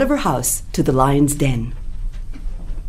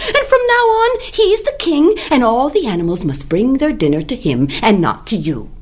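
higher, breathless pleading rabbit; rabbit's plea to the king; 7 semitones higher than usual. Acted. Rabbit tells the lion a story to save herself. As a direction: spin the tale with fake distress.

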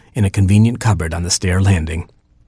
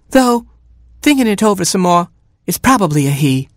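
higher mother hen voice; a fussy mother hen, pitched up; Mama Hen voice; 11 semitones higher than usual. Acted. Mother hen finding her oatmeal eaten, higher voice.

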